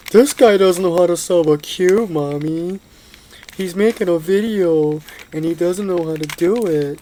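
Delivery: put-on high, loud tattling kid voice; mocking child. Mocking kid tells mommy about the cuber, high loud tattling.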